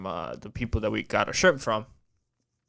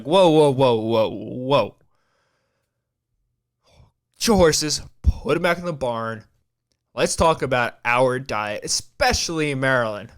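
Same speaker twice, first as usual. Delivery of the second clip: mock calming whoa; 5 dB louder than usual. Retold calming down the friend.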